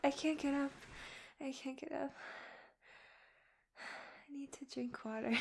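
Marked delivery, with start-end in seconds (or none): 1.4-5.3 drained, soft whisper. Real, not acted. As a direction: whisper it, worn out. Exhausted after climax.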